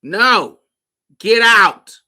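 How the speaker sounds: loud, high get out; mock shout. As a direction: throw him out with a yell. Throwing him out.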